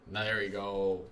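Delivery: ordinary talk, nothing marked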